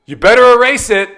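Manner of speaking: mock-angry dad laying down the law; imitating stern dad. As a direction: demand it like an alarmed father. Acts out dad's stern demand.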